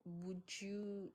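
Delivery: usual speaking voice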